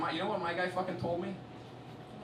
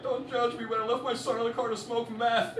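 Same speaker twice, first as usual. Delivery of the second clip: put-on older woman confessing; 9 semitones higher than usual. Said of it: "a sixty-year-old woman in front of you going".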